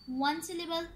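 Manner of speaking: usual speaking voice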